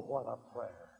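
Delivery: normal talking voice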